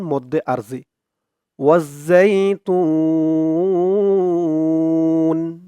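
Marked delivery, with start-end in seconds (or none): 1.6-5.6 ওয়াজ্জাইতুন with the তু held three alif long; drawn-out tun. Shown as a demonstration. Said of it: "তু বলে তিন আলিফ টান দিয়ে". (ওয়াজ্জাইতুন-এ তু তিন আলিফ টেনে)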